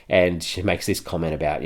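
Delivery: normal talking voice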